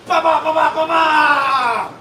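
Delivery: loud "ba ba ba" with a held last note; vocal punchline sting. Pretend. Comic capping his hammered joke, loud vocal sting. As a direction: belt out a mock fanfare. Vocal rimshot after pun.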